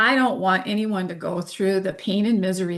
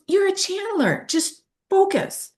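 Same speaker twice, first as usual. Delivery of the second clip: insistent friend; 13 semitones higher than usual. Retold or imitated. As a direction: mimic her pushing you, pitched way up. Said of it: "She goes".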